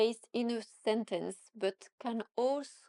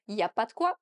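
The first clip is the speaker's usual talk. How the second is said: faster; 3 dB louder, much faster than usual. Shown as a demonstration. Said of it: "say a little bit faster".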